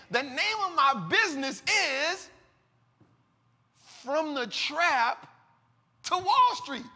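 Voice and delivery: slow and high, "From the Trap" and "to Wall Street" set apart by long pauses; emphatic. Real, not acted. Speaker letting his business name sink in, emphatic. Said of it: "let that sink in".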